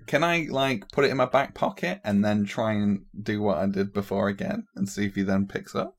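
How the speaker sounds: normal talking voice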